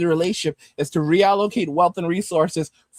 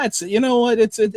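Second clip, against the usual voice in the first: usual speaking voice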